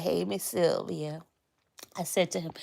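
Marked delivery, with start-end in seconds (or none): 0.0-1.2 imitating him. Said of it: "He be like".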